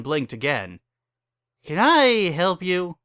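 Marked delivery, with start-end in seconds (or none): 1.6-3.0 slow, drowsy and a little higher, just woken; a groggy barkeep greeting customers; tipsy shopkeeper. Acted. Groggy Barry greets the couple at the counter, drowsy.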